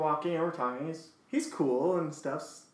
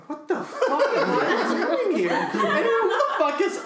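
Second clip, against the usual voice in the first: high, loud, laughing disbelief; hammed-up outburst cracking into laughter; retold exasperation; 6 dB louder than usual. Date retelling her thoughts while roommates yell, laughing exasperation. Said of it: "I'm just going".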